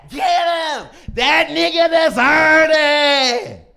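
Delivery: shouted high, dragged out slow; mob shout. Retold or imitated. Crowd yelling approval at a beating, slow and shouted. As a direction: yell it like an angry crowd egging on a fight.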